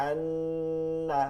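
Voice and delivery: আন্না with a very slow held nasal nn; nasal ghunnah. Shown as a demonstration. Teacher demonstrating ghunnah to a class, very slow. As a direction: stretch the nasal hum in আন্না. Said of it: "গুন্নাই একটা গুন্না".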